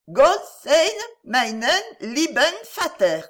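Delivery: put-on a proud little boy saying his German lesson aloud; child speaking German. A narrator's reading. Son reciting a German blessing for his father, proudly and clearly. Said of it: "je vais parler allemand".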